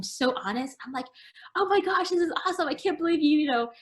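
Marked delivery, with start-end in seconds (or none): 1.6-3.7 high, gushing mock enthusiasm; gushing excitement. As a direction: replay your own overeager delight. Retelling her own gushing reply.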